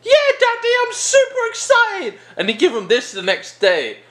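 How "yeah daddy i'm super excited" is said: very high, squeaky mock-excited kid; hammed-up falsetto excitement; excited teenager. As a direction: squeal it like an overjoyed child. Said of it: "so excited".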